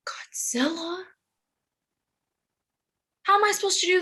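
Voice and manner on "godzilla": breathless whispered question; whispering. Acted. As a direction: whisper it in disbelief. Shocked reply to prophecy.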